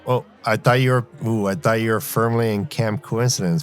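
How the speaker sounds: ordinary delivery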